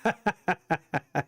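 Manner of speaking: a run of short, even chuckles; chuckling laugh. Real, not acted. Amused after showing original language, chuckling laugh. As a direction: chuckle in separate little bursts.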